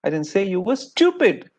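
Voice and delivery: STUPID jumped up high and hit hard; emphasis landing on the last word, "stupid"; stress on STUPID. Shown as a demonstration. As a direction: save all the weight for "stupid". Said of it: "the emphasis shifts".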